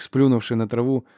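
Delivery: plain reading voice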